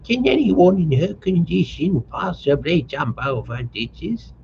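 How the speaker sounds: high, slowed fussing mother; scolding mother. A narrator's reading. Mother scolds her daughter.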